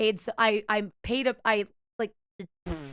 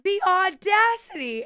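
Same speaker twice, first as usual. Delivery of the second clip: high, loud, drawn-out indignation; theatrically aghast, each syllable stretched; mock outrage; 9 semitones higher, 5 dB louder than usual. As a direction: play up the scandalized disbelief for laughs. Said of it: "I tried to be funny about it".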